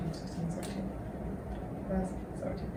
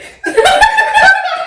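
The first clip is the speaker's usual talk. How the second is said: big high burst of laughter; loud laugh; 12 dB louder than usual. Real, not acted. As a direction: crack up loudly at the punchline. Burst of laughter after punchline.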